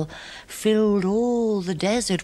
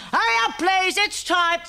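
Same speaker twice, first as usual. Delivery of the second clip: much higher, ringing closing-time call; barman's call; 13 semitones higher than usual. Acted. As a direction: call last orders across the pub.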